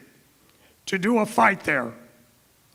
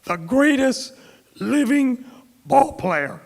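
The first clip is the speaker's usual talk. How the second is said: announcer intro; 4 semitones higher, slower than usual. Shown as a demonstration. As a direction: lightly boom it out like a ring introduction. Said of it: "he liked being introduced as".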